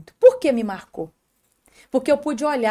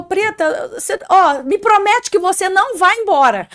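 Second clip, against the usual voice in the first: a mock tearful plea, higher and louder; imitating husband crying; 7 semitones higher, 7 dB louder than usual. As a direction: play the weeping husband begging, lightly. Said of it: "Mas ele chorava copiosamente". (marido chorando pede à esposa que não parta, imitação leve de choro)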